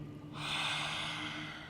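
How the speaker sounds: breathy, tongue-out roaring exhale; lion's breath exhale. Shown as a demonstration. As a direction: push the air out with a long open-mouth sigh. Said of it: "Lion's breath out, let it go".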